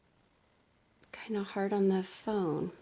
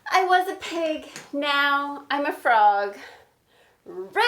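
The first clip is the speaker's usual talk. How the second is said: loud, higher, slow proud declaration; Piggy proudly; 4 semitones higher, 10 dB louder than usual. Acted. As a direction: state it like a fact everyone should know. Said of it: "when I say the piggy parts".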